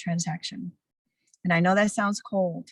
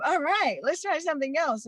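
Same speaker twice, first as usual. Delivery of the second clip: relieved therapist; 8 semitones higher than usual. Retold or imitated. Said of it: "how many therapists appreciate that and go".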